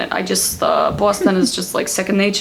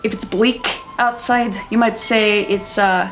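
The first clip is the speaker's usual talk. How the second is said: storytelling Scottish accent; measured Scottish storyteller. Shown as a demonstration. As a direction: explain it in a Scottish lilt. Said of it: "The Scottish accent is like".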